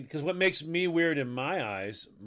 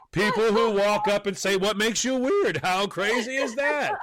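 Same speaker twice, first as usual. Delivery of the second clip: high-pitched, exasperated comic rant; mock outrage; 8 semitones higher than usual. Comic turning on whoever asked the question, mock outraged. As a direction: rant in a raised, incredulous pitch.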